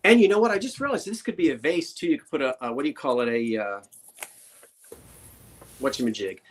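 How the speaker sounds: ordinary talk, nothing marked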